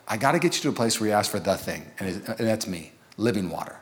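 lightly voiced Jesus, drop low and soft; Jesus speaking. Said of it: "and Jesus is like".

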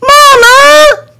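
very high, loud cry; panicked scream. Acted. Boy scrambling to the camper, panicked scream. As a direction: shriek for mom while fleeing.

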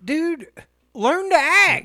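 high, loud exasperated yell; exasperated shout. Real, not acted. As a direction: yell it in disbelief. Mock exasperated advice.

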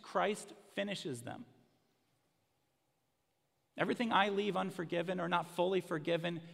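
rising, fervent preaching voice. Acted. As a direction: build to the gospel climax. Climax of sermon.

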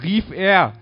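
high, very loud cry; excited full-volume call; shouted. A narrator's reading. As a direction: shout it as an exclamation.

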